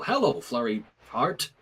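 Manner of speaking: usual speaking voice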